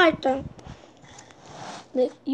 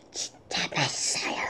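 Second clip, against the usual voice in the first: low unvoiced whisper; 13 semitones lower, 11 dB quieter than usual. Shown as a demonstration. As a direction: whisper it close to the mic. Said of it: "조그맣게 하는 거지".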